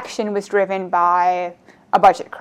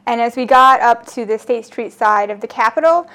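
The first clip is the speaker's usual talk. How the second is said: usual speaking voice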